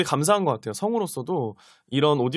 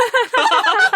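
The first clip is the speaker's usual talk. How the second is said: high squealing laugh; burst of laughter; 6 dB louder than usual. Real, not acted. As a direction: laugh out loud at your own joke. Laughing at her piano joke.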